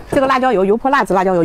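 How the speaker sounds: usual speaking voice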